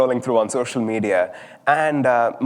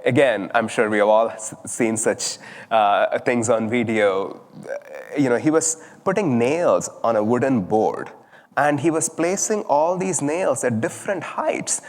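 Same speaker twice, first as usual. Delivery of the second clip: usual speaking voice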